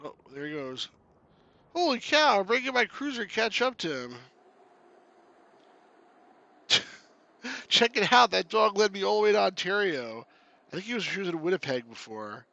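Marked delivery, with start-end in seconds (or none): 1.8-11.9 high, loud, excited Canadian character; amazed Canuck hero. Acted. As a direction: exclaim in amazement as a cartoon Canuck.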